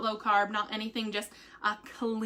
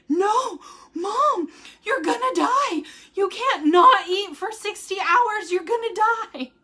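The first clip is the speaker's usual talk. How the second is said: high, loud imitation of a panicking child; Logan in panic; 8 semitones higher, 5 dB louder than usual. Mother imitating her son begging her not to fast, panicked. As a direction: plead with Mom, stretched and high, in mock panic. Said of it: "he just had this sheer panic".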